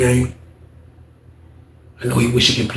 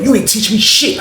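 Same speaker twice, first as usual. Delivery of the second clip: straight-up retort; 7 semitones higher than usual. Retold or imitated. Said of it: "told that man straight up".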